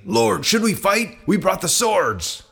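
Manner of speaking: high, alarmed disciples' cry; urgent exclamation. Acted. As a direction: call out urgently, pitch jumping up. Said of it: "they exclaimed".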